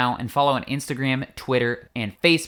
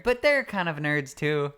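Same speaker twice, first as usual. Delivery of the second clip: ordinary talk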